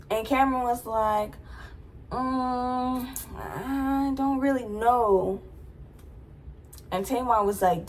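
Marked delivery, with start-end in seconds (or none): 2.1-5.4 higher, slow hesitant shrug of a voice; lightly voiced sheepish friend; evasive. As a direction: play dumb, drawn out. Cameron evasive answer.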